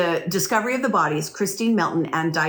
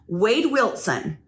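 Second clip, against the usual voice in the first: normal talking voice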